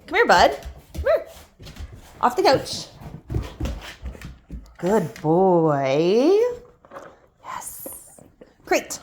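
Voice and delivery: high, bright dog-praise voice; talking to a dog. Real, not acted. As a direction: call the dog in a high sing-song.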